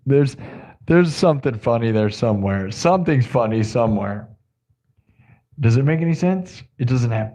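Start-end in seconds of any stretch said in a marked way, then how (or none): none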